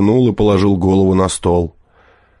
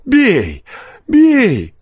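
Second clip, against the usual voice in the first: high, drawn-out furious cry; fierce; 3 dB louder than usual. A narrator's reading. Peasant daring forester as girl stares, fierce voice. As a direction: dare the blow at full pitch. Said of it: "подхватил мужик свирепым голосом".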